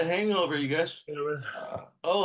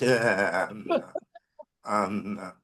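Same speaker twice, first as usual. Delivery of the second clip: usual speaking voice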